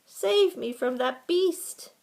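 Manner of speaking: high, loud desperate plea; pleading. A narrator's reading. Mouse begging the gopher to save him, pleading. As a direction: beg loudly to be saved. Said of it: "pleaded Ralph".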